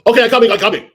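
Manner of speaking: high, loud mock compliance; imitated hurried reply. Housekeeper called upstairs, imitated harried reply. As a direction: call back as the harried helper.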